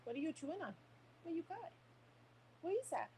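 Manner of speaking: high, soft puppy talk. Real, not acted. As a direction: coo at the dog softly. Talking to her puppy.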